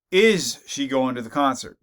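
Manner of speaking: Is hit hard and loud at the start; stress on Is. Shown as a demonstration. Teacher showing how stress shifts meaning, weight on Is. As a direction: punch the opening Is, demanding a yes or no. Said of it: "So in terms of emphasis".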